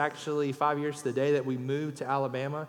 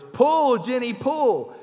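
high, loud call to a horse; farmer's yell; 8 semitones higher, 8 dB louder, much slower than usual. Acted. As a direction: holler it out, drawing out the last word. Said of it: "he yells".

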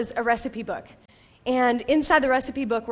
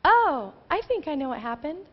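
higher, knowing "oh"; mother-in-law's realization; 4 semitones higher than usual. Retold or imitated. Ben's mom looking inside the fridge, knowingly. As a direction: imitate a gentle, dawning discovery. Mother-in-law spots the cause.

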